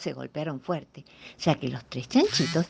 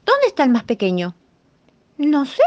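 piglet voice; 8 semitones higher, 7 dB louder than usual. Acted. Piglets ask for the lost one.

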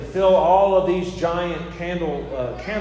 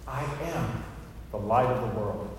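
much softer, lower, reverent echo of the words; hushed repeat; 7 semitones lower, 8 dB quieter than usual. Acted. Repeating Jesus' words to the congregation, hushed. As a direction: let it sink in, quietly and low.

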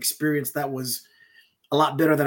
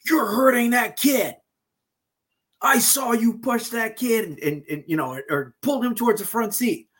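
accusing shout; 6 semitones higher than usual. Retold or imitated. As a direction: call him out as if he's hurting a child. Stranger accuses him of hurting kid.

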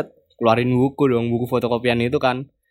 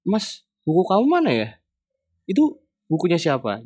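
mild retold questioning, pitched up; lightly voiced lecturer questioning; 6 semitones higher than usual. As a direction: imitate a teacher's higher-pitched challenge. Lecturer confronts student mid-class.

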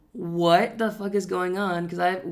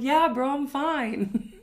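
slow, higher, laughing stoned voice; 8 semitones higher, much slower than usual. Retold or imitated. Stoned him insisting to his friend he's fine, slow and laughing. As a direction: play yourself too high to talk.

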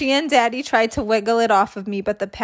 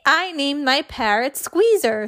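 plain reading voice

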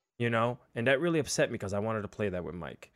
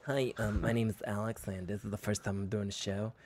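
softer, bashful first-timer intro; shy newcomer; 2 semitones lower, 8 dB quieter than usual. Pretend. Playing the nervous first-time streamer to his viewers, bashful and soft. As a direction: introduce yourself like a nervous beginner. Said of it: "Hi, it's my first time".

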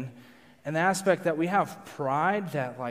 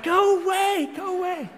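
mock shooing; 4 dB louder than usual. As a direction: shoo them away high, fading on the repeat. Acts shooing off visitors.

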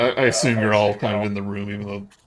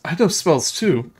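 put-on higher, louder girlish boast; proud little girl; 9 semitones higher, 6 dB louder than usual. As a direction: pipe up proudly as a child. Rose boasts to the visitors.

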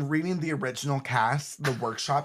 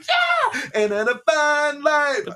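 much higher, loud, triumphant; cheering; 14 semitones higher, 8 dB louder, slower than usual. Retold or imitated. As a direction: cheer like the whole crowd of newsies. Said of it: "they're like".

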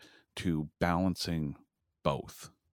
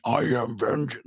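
louder, higher mock battle cry; mock scream; 5 semitones higher, 5 dB louder than usual. Co-host parodies a full-blown superhero entrance, mock scream. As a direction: imitate an over-the-top screamed hero line.

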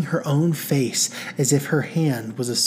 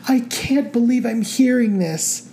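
breathless disbelief; 11 semitones higher, 3 dB louder than usual. A narrator's reading. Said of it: "struggling to catch the breath in her chest".

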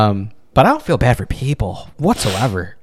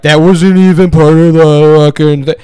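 mock complainer; 4 semitones higher, 8 dB louder than usual. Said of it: "they were like".

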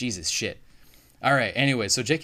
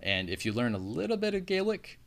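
ordinary talk, nothing marked

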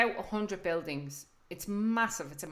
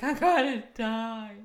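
mock doom; 3 semitones higher, 3 dB louder, slower than usual. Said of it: "And it's like".

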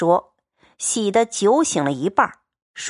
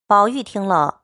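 usual speaking voice

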